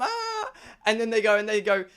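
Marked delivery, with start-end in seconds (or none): 0.0-0.5 high, soft squeal of excitement; excited squeal. Acted. Friends hyped for the trip, excited squeal. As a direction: let out a little high-pitched squeal.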